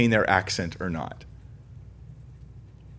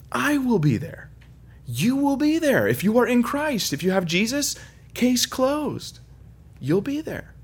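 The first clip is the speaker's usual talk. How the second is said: high, insistent assurance to the congregation; emphatic assurance; 12 semitones higher, slower than usual. Real, not acted. Preacher assuring believers, high and insistent. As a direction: lift it up high and sure.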